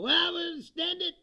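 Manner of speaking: high, loud mock politician voice; mocking the president. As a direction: toss it off like a smug leader dodging a deadline. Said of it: "He's gonna go".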